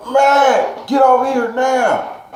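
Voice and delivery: sharp high grandma summons; grandma yelling. Retold or imitated. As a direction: play grandma ordering the kid inside. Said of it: "my grandma opens the door and she's like".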